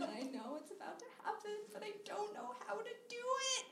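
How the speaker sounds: small, soft voice climbing high; quiet inner panic. Acted. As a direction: squeak out a hushed, mounting mock panic. Inner panic before first kiss.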